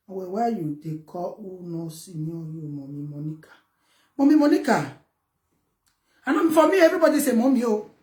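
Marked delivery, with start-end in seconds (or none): 0.1-3.5 mock commenter voice. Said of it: "Wait, me I find comment here".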